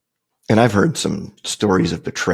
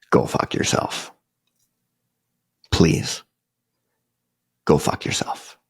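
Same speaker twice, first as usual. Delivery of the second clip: calm; 3 semitones lower, 3 dB quieter, slower than usual. Retold or imitated. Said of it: "I stayed pretty calm throughout".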